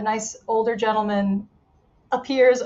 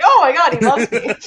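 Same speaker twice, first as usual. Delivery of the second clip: excited; 3 semitones higher, 8 dB louder than usual. Retold or imitated. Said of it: "I'm like".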